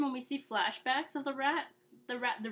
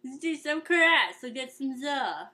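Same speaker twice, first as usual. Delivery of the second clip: put-on Brooklyn accent; 3 semitones higher, 7 dB louder than usual. Rat proposing karate and za to turtles, mock Brooklyn accent. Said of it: "If a Brooklyn".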